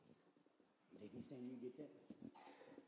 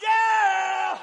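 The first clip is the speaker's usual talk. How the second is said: a high shouted yeah; excited shout; 8 semitones higher than usual. Real, not acted. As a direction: cap the run with a cry. Preacher exclaims after climax.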